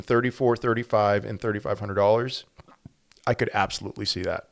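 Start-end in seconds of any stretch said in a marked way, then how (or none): 2.4-3.2 whispering. Real, not acted. Musing on gold price aside.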